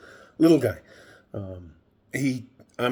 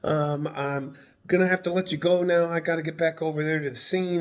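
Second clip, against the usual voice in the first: higher, fast nervous officer; hurried officer; 7 semitones higher than usual. Retold or imitated. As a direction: rush the brush-off like a nervous cop. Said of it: "He goes".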